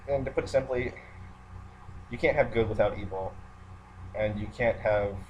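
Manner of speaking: ordinary talk, nothing marked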